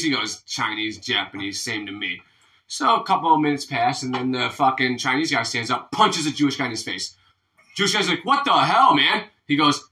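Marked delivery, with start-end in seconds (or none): 0.4-2.2 low, soft throwaway punchline; deadpan dismissal. Acted. Attacker's dismissive punchline in joke. 8.2-9.3 high, loud mock protest; acted indignation. Punched man protests in joke.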